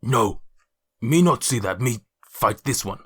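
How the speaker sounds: a hint of a gruff half-orc, broken speech; lightly voiced brute talking in simple words. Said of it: "tries to desperately remember what her voice was like".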